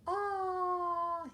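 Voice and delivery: very high scream of terror; Sully screaming when the girl clings to him; terrified scream. A narrator's reading. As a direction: shriek as if touched by something awful. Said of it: "he screamed in terror".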